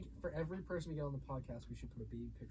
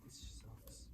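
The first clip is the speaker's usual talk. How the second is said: whisper; 3 semitones lower, 11 dB quieter than usual. Real, not acted. Grumbling aside at conference venue.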